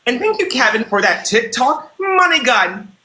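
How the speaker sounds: high, sing-out thank-you announcement; grand gift shout-out. Pretend. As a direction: draw out the gift's name with ceremony.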